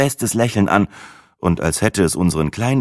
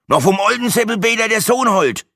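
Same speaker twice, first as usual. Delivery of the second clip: put-on higher, louder grumbling old Franconian; grudging Franconian grumble; 4 dB louder than usual. Old man grumbling back at Brenda in Franconian. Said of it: "raunzte es unwillig zurück".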